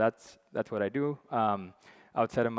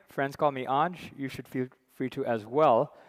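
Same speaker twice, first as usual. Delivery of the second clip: ordinary talk, nothing marked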